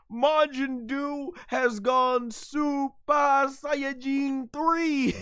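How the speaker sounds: high, slow, drawn-out reveal; hype announcer. Acted. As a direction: announce it like a huge title moment. Dramatic announcement of transformation.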